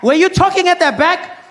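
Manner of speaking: high, loud stern teacher scolding a pupil; angry teacher. Acted. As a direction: bark at the girl in the back row like a strict teacher. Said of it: "teacher walk into the classroom with a cane".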